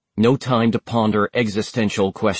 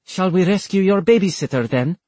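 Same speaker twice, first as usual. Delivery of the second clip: teasing; 8 semitones higher than usual. A narrator's reading. Rabia teasing.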